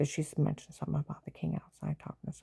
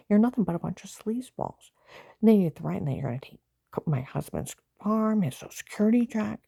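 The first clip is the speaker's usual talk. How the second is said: loud, higher, telling them off; furious rant; 4 semitones higher, 6 dB louder than usual. Real, not acted. Lashing out at the neighbors who threaten her, furious. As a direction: spit the insult at them.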